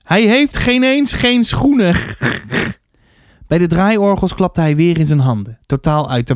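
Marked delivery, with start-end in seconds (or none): 0.0-2.0 high, drawn-out hilarity breaking into laughter; laughing hard. A narrator's reading. Arie finds the rabbit's shoes hilarious, high laughing delivery. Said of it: "Daar moest hij heel erg hard om lachen". 2.0-2.7 throaty, snorting laugh; acted guttural cackle of amusement; hard laughing. A narrator's reading. Arie cracks up about the rabbit's shoes, throaty laughing. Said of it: "heel erg hard om lachen".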